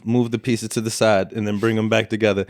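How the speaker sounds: usual speaking voice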